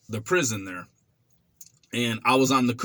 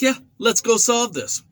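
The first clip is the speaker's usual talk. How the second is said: high, louder cocky eagerness; eager tough guy; 10 semitones higher, 4 dB louder than usual. Retold or imitated. Young inmate accepting the challenge, cocky and eager. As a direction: jump at the fight. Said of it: "I was like".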